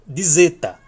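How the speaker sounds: Dizeta said loud with a clear D before zeta, /dizetɐ/; model for repeating. Shown as a demonstration. Teacher asking students to repeat Dizeta, loud and clear. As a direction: say Dizeta loud so the class can copy it. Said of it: "Repita comigo".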